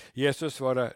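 ordinary talk, nothing marked

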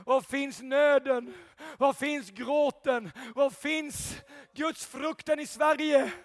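loud, rising sermon delivery, building to shouts; fiery preaching. Acted. Preacher rousing the church, shouting praise. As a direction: preach it at full force to the congregation.

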